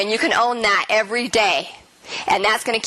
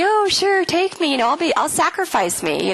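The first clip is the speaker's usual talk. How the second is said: high, mock self-sacrificing voice; hammed-up offering of oneself; willing martyr; 8 semitones higher than usual. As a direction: play the eager martyr, pitched up. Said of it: "probably a role I played".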